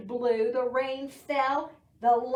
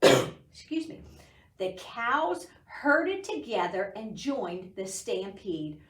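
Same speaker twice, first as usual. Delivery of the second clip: ordinary delivery, nothing marked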